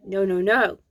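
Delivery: ordinary delivery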